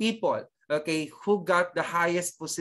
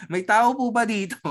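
laughing question, higher and louder; 5 semitones higher, 5 dB louder than usual. Real, not acted. Teacher asks whether anybody is there in the silent class, laughing. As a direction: call out through a laugh.

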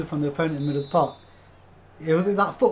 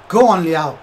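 louder, more insistent "Go on, Liao"; urging shout; 10 dB louder, slower than usual. Real, not acted. Streamer urges his striker forward, louder. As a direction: push the player on, louder.

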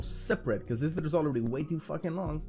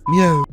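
loud meow; 5 semitones higher, 14 dB louder than usual. Pretend. Repeated meow sound drop.

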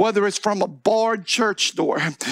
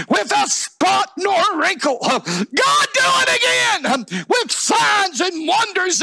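high, crying shout to God; full-force prayer preaching; 11 semitones higher than usual. Real, not acted. Preacher begging God for a holy generation, impassioned shouted prayer. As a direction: belt out each plea like a revivalist.

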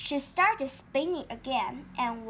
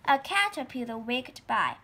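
ordinary delivery

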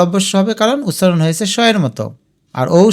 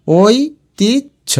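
ঐতিহ্য very slowly as oi, tij, jho; higher, long gaps inside ঐতিহ্য; syllable by syllable; 5 semitones higher, much slower than usual. Shown as a demonstration. Teacher sounds it out for spelling, ঐতিহ্য slowly split. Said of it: "ভেঙে ভেঙে লিখবে".

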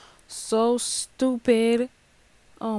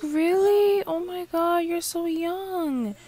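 surprised neighbor; 6 semitones higher, slower than usual. Retold or imitated. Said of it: "She's like".

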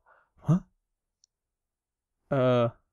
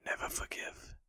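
soft, airy, toneless whisper; ghostly whisper; 22 dB quieter than usual. Acted. Vengeful ghost repeating her curse, eerie whisper. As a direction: breathe it like a ghost.

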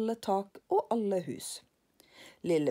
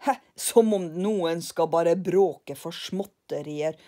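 louder, slow scornful scoff; scoffing Karlsson; 7 dB louder, slower than usual. Acted. Karlsson scoffs at the angry man, louder and slow. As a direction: scoff at the fuss.

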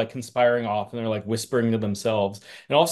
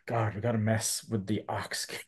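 much softer, lightly acted dismay; Colonel Silver; 10 dB quieter than usual. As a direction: mutter it quietly, dreading the job. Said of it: "Colonel Silver's like".

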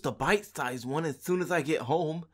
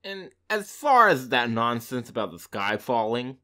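much lower, loud sneering; 12 semitones lower, 9 dB louder than usual. A narrator's reading. Fox mocks the birds, low and sneering. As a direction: scorn it. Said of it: "he sneered".

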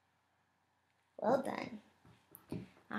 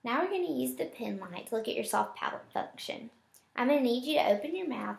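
ordinary talk

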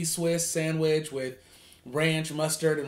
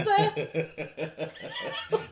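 high laugh. Real, not acted. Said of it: "Is that how you gotta say Cheetos".

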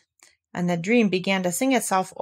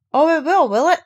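a hint of a pompous owl, higher and louder; lightly voiced Owl; 7 semitones higher, 6 dB louder than usual. Owl answering Christopher Robin, lightly pompous voice. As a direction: Owl repeating back, mildly pompous.

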